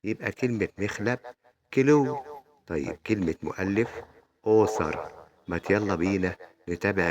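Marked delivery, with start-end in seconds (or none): 1.7-2.2 كيلو said loud and high, "claw" with an O vowel. Shown as a demonstration. Teacher giving the word for claw, emphatic O sound in كيلو. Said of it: "بتتنطق". 4.5-5.3 أوثر opening on a loud "aw". Shown as a demonstration. Teacher giving the word for author, emphatic O in أوثر. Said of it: "بتتنطق".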